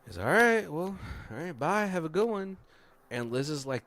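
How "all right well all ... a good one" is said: high, singsong farewell; breezy acted goodbye. Man leaving the agents, breezily high-pitched. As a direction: wrap it up lightly, pitched high.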